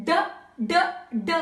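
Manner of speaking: short staccato "d" like drum beats; phonetic D sound. Shown as a demonstration. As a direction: sound out "d" as a short, separate beat. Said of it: "started playing the drum like".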